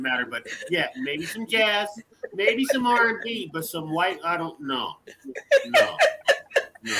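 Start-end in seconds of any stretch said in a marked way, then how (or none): 5.6-6.9 sudden high yelped no; squeaky no. Real, not acted. Refusing the host's question, high yelp. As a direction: pop the no way up high.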